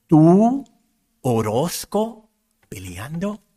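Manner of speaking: imitating a teacher's shocked disbelief, name drawn out slowly; half-acted gasp of disbelief at catching a pupil fighting; surprised teacher. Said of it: "Sorprendida me dijo".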